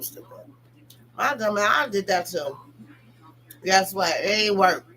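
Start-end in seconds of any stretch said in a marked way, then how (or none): 0.0-0.5 whispered self-rebuke; scolding her own past self in a whisper. Real, not acted. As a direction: breathe it out at yourself. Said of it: "I did that shit too".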